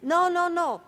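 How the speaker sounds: loud protest. Acted. Anxious refusal to Oscar.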